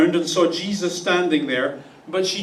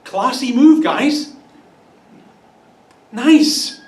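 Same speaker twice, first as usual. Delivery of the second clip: sarcastic praise; 4 semitones higher, 6 dB louder, slower than usual. Acted. Mocking disciples leaving crying woman.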